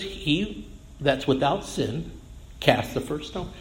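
a slow, hushed verdict; measured gentle scripture quote; quiet, slow quote. Acted. Quoting Jesus a second time.

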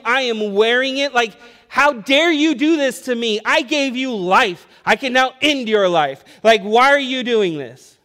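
loud, high, hammed-up parental outrage; mock angry tirade. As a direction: scold with drawn-out, punched words, as pretend fury. Acted tirade he never gave.

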